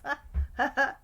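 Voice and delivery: quick high giggle; short laugh. Real, not acted. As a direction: burst of real laughter. Laugh after bandit remark.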